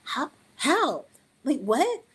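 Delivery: imitating her own baffled reaction; mock-incredulous how, how, what; stunned disbelief. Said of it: "And I was like".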